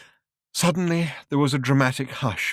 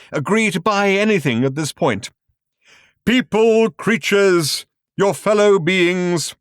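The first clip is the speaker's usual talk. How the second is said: booming, high grand showman voice; grand announcer; 9 semitones higher, 7 dB louder, slower than usual. A narrator's reading. Film narrator booms at the audience, grand and loud. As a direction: proclaim it like a cosmic showman.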